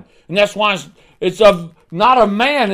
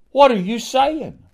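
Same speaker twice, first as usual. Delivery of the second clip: indignant challenge; 9 semitones higher than usual. Acted. Preacher challenges the doubter.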